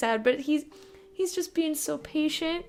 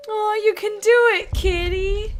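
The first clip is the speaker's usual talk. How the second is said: encouraging; 6 semitones higher, 6 dB louder than usual. Real, not acted. Cheering on the cat.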